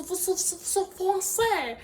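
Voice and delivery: français in a mock French accent. Français said with a put-on French accent. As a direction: show off a pretend French accent to wellness fans. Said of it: "Maybe speak in some, some French".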